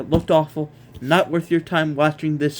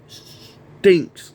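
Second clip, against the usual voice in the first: emphatic loud; 3 semitones higher, 6 dB louder than usual. Real, not acted. Ranting about a bad show.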